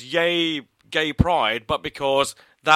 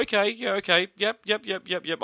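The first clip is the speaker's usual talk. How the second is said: higher, rapid little nods of agreement; coming round; 6 semitones higher than usual. Retold or imitated. Telling co-host how the trailer grew on them, coming round. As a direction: act out being gradually won over.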